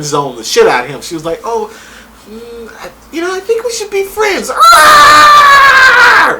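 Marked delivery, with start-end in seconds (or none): 1.4-4.5 high squeaky mock of her letting him down; mock girly voice. Recapping the show, mocking the girl's friend-zone line. 4.5-6.4 loud high shriek of disbelief; scream. Real, not acted. Reacting to the friend zone, a loud high shriek. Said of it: "Like, she did that".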